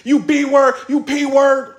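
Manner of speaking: high, loud mock insults; crazy talk. Imitating a battle rapper insulting his rival, high crazy rant. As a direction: hurl it like an over-the-top rapper. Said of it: "He talking crazy".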